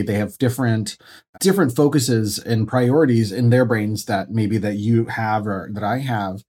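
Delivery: ordinary talk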